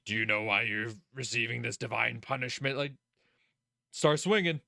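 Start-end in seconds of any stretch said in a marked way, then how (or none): 0.1-2.8 a lightly put-on grandiose avenger; mock villain monologue. Mocking Keiichi lecturing the uncle, lightly hammed villain speech. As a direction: lightly ham up a smug hero monologue. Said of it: "monologue or some shit".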